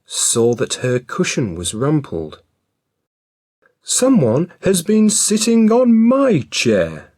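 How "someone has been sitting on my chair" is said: high, loud mother bear voice; middle-sized Mother Bear voice. A narrator's reading. Mother Bear finding her rumpled cushion, high and loud. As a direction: lift it up for a fussy mother bear. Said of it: "she said in a middle-sized voice".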